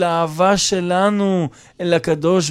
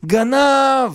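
high, drawn-out mock shouting; accusing yell; 4 semitones higher, slower than usual. Guest starts yelling at host over wallet, accusing. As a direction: cry out the accusation, pitch raised. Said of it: "ואז האורח התחיל לצעוק".

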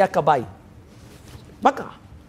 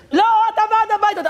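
high-pitched mock accusation; accusing neighbor; 12 semitones higher than usual. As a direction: imitate a trapped woman blaming him. Acted neighbor accusing him.

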